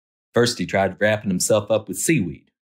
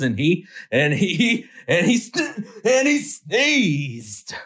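a comic sneeze winding up, high and stretched; building sneeze; 10 semitones higher, slower than usual. A narrator's reading. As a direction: pitch climbs way up through stuttered sneeze build-up.

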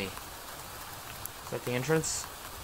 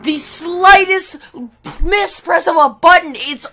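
raised, louder complaining rant; frustrated rant; 8 semitones higher, 6 dB louder than usual. Real, not acted. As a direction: vent about the unfair game. Ranting after losing again.

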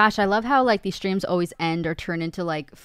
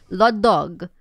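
put-on louder, Italian-accented "la dog"; grandmother's Italian English; 8 dB louder than usual. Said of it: "kinda turn them into Italian".

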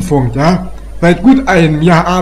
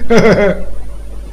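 smug chuckle; 3 semitones higher than usual. Acted. Officer laughs at own joke.

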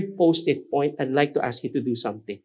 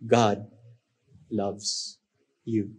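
soft and low, slow reassurance; quiet pastoral blessing to closed eyes; tender, hushed; 5 semitones lower, 4 dB quieter, slower than usual. Shown as a demonstration. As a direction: lay each word gently on their hearts. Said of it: "I'll say the post-it reminder three times".

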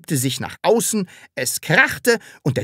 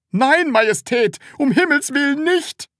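high, loud, panicked plea; desperate cry; 4 dB louder than usual. A narrator's reading. Seller begs the Sams not to breathe, desperate. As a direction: beg them to stop in a high voice. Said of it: "rief der Verkäufer verzweifelt".

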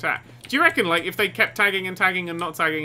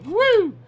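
short high "woo"; whoop; 4 dB louder than usual. Real, not acted. Player whooping in the creepy level, high whoop. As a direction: let out a high-pitched whoop.

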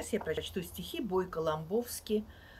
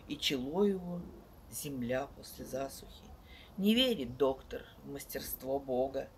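loud, sweeping poetry recital with big swells; dramatic poetry declamation; 6 dB louder than usual. Acted. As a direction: declaim each line like on stage. Reads poems aloud to audience.

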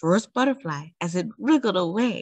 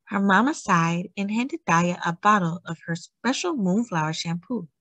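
plain reading voice

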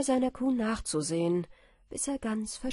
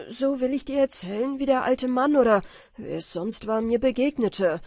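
louder, pressing account; insistent explaining; 4 semitones higher, 8 dB louder than usual. A narrator's reading. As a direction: talk over her objections. Michael erklärt sich laut. (Michael beharrt lauter)

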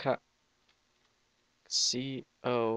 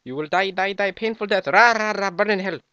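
villain taunt; 5 semitones higher, 7 dB louder than usual. Acted.

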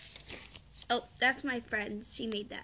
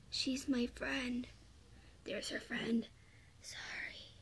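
hushed little sorry; whispered aside; 13 dB quieter, slower than usual. Real, not acted. Whispering an aside while showing drawings. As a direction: drop to a whisper.

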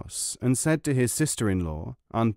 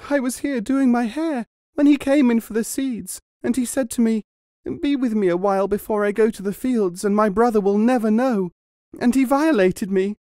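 high and louder, weeping lie; tearful accusation; 11 semitones higher, 4 dB louder than usual. A narrator's reading. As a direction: play the wronged wife sobbing her story. Wife's false tearful accusation.

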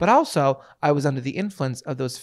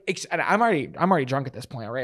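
ordinary delivery

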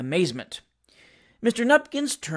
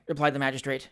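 plain reading voice

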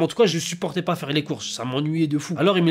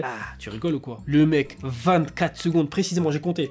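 normal talking voice

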